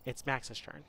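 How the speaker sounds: normal talking voice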